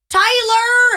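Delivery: high, loud drawn-out yell; husband-calling holler. Pretend. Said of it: "how they would call their husbands".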